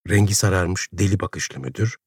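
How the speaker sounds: ordinary delivery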